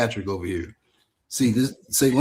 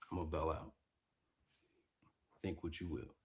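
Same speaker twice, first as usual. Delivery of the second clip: quiet; 6 semitones lower, 22 dB quieter than usual. Real, not acted. Leaving call, muted.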